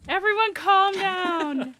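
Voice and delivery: slow, high, drawn-out calm down; soothing plea. Acted. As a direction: coax them to settle. Lulling enemies to sleep.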